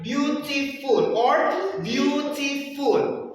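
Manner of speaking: Beautiful split slowly into syllables; syllables cut apart. Shown as a demonstration. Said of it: "You cut it, cut it".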